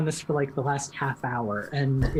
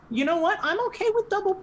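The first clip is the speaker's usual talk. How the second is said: pitched way up and fast; high squeaky voice; faster than usual. Pretend. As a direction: squeak it out excitedly. Repeats the line, then jumps high.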